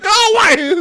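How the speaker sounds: high, loud imitated yell; mock angry dismissal. Cousin fed up with being teased, mock-angry yell. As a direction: shoo him off with a hammed-up shout.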